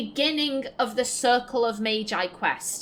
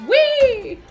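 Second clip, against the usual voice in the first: gleeful whee; 13 semitones higher, 8 dB louder than usual. Real, not acted. Gleeful cry in combat.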